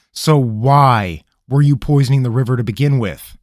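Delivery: an irritated ranger; stern interrogator. Acted. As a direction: cut through his stalling. Rabineau voice.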